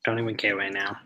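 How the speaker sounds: ordinary talk, nothing marked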